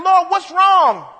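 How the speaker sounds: slow, high, loud plea to the Lord; crying out. Acted. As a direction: drag out a desperate cry of "Lord". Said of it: "They cried out to him saying".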